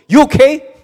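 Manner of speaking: high, loud, fussing concern; a flurry of loud anxious check-in messages; alarmed friends. Acted. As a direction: gush over them like worried church friends. Life group members reacting to post.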